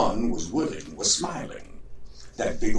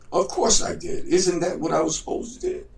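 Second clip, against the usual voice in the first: higher, insistent; a hint of a proud fool protesting; indignant George; 9 semitones higher than usual.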